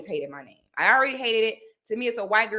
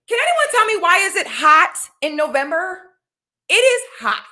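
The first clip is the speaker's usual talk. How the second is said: high, loud complaining about the heat; much higher, louder disbelieving whine; exasperated outcry; 9 semitones higher, 7 dB louder than usual. Real, not acted. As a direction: throw up your hands at the weather, pitched high and loud.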